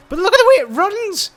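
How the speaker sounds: very high, loud, thrilled exclamation; delighted squeal. Real, not acted. Player watches the odd creature run, delighted squeal.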